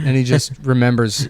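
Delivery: ordinary talk, nothing marked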